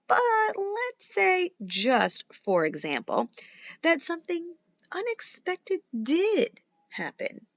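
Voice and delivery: put-on lively read-aloud for kids; animated children's story reading; story narration. A narrator's reading. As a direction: read it like a bedtime picture book. Reads picture book to children.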